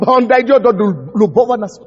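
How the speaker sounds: normal talking voice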